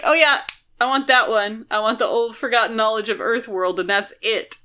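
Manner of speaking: ordinary talk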